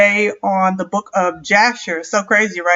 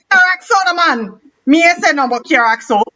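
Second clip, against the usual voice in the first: put-on higher Caribbean accent, "Axel" said "Axo"; playful Caribbean lilt, pitched up; 6 semitones higher than usual. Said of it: "I gotta say it with an accent".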